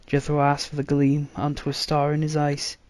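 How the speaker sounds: plain reading voice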